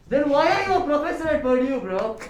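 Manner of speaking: high, loud mock indignation; exasperated outburst. Student challenging a professor at Purdue, in mock exasperation. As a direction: fire it back in acted exasperation.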